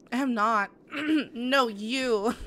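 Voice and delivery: fighting back happy tears; voice cracking. Real, not acted. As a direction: caught in the throat, voice breaking. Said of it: "making my voice crack".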